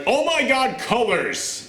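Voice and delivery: high-pitched thrilled outburst; excited exclamation. Retold or imitated. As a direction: blurt it out high, amazed and delighted. Retells delighted reaction to film's colours.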